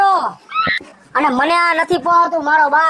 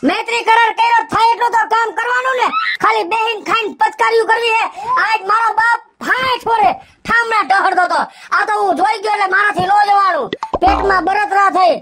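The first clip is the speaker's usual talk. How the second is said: high, fast, furious outburst; angry shouting; 5 semitones higher than usual. Acted. Husband scolding his lazy wife over the dishes, angry shout. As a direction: let it boil over at her.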